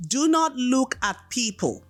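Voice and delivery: usual speaking voice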